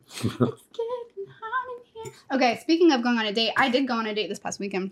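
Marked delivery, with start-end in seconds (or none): 0.1-2.1 high, slow, strained squeak; mouth burning from spicy food. Real, not acted. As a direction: squeak it out through the burn. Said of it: "I might start crying".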